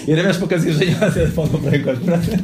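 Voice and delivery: amused, laughing aside. Real, not acted. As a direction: chuckle through the words. Amused aside about a listener.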